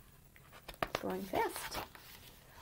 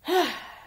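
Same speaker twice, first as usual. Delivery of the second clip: exasperated sigh before carrying on; long sigh; 10 semitones higher than usual. Acted. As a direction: let out a big weary sigh. Elf takes deep breath.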